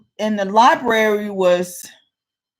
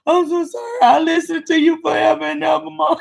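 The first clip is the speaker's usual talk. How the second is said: mock contrite child; 8 semitones higher, faster than usual. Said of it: "And I don't know".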